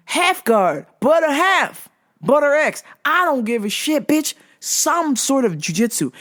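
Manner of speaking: high-pitched, exasperated rant; pissed off. Real, not acted. Fan demanding some jujitsu from fighter, pissed off. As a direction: reel it off high, fed up. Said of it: "I was pissed".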